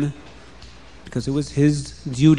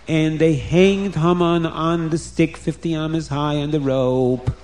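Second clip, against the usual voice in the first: ordinary delivery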